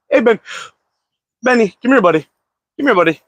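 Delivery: high, coaxing call to the dog. Real, not acted. Owner calling his dog Benny to come over, high coaxing call. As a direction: lift the voice to coax the dog over.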